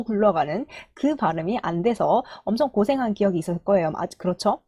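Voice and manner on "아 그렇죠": normal talking voice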